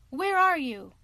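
ordinary delivery

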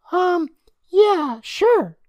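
put-on hesitant, very high kid voice; loud, high child voice=25; high-pitched boy. A narrator's reading. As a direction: answer like a squeaky little boy. Day Day answers.

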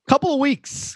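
high laughing answer. Real, not acted. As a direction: laugh it out in embarrassment. Laughing at awkward question.

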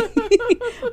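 bright loud burst of laughter. Real, not acted. Host laughing at listener yelling at their trivia.

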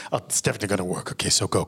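ordinary delivery

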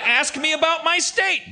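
high-pitched tailor-governor patter; hammed-up salesman. Comic as governor who sells suits, hammed-up high salesman. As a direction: sell it high, like a catchphrase.

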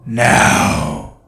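slow, deep menacing monster voice; demonic growl. Acted. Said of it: "The now was significantly deeper, almost demonic".

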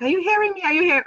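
imitating angry husband. Imitating husband demanding answers from silent wife, mildly angry. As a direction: press for an answer, mildly exasperated. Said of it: "Why is he getting angry?".

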